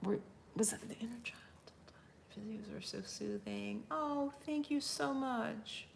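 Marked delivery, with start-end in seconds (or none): 0.6-3.8 quick whisper, fast; whispering to herself. Real, not acted. Streamer whispering while scanning the chat. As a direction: hurry the question in a whisper.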